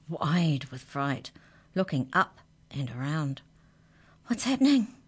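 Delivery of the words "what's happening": high-pitched startled question; urgent cry of confusion, pitched up; alarmed cry. A narrator's reading. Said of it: "he cried".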